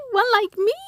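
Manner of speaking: astonished. Acted. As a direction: echo it in amazed delight. Mimi repeats, more astonished.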